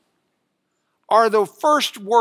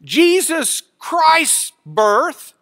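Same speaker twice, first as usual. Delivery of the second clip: "Jesus Christ's birth" loud, high, very slow; emphatic red-ink words; 9 semitones higher, 7 dB louder, much slower than usual. Shown as a demonstration. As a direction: hammer out each word of "Jesus Christ's birth". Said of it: "Greek word order for emphasis".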